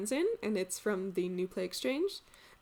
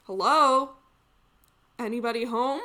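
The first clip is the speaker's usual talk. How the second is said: calling out; 3 semitones higher, 9 dB louder than usual. Acted. Maid calling into a tavern from outside, calling out. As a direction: call into a building for someone.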